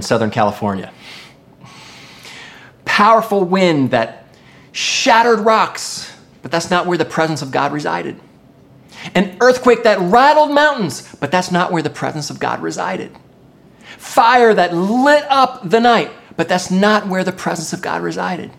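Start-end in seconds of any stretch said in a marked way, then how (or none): 2.8-6.1 slow, emphatic preaching build; drawn-out, high-pitched dramatic emphasis; dramatic force. A narrator's reading. 9.1-11.0 high, emphatic preaching swell; raised, intense preacher's voice; dramatic force. A narrator's reading. 14.0-16.1 slow, high preaching swell; drawn-out, raised dramatic delivery; dramatic force. A narrator's reading.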